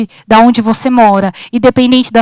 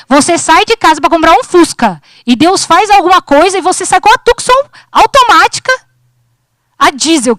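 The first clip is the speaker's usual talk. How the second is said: rising excitement; 6 semitones higher than usual. Real, not acted. Climax of God's surprise.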